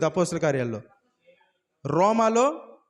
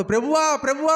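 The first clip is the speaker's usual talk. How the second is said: high, loud panicked cry of Lord, Lord; urgent pleading yell, much higher and louder; desperate shout; 7 semitones higher, 4 dB louder than usual. Shown as a demonstration. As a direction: shout it as the last chance slips away. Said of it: "అని అరుస్తారు".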